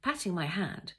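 usual speaking voice

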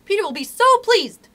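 high, loud gleeful cry; excited scream. A narrator's reading. Tink egging Toodles on, excited scream. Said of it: "she screamed".

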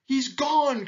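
very high, gleeful call; excited cry. A narrator's reading. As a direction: call out the news like a monkey. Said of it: "cried the monkey".

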